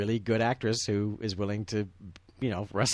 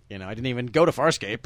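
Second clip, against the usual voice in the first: normal talking voice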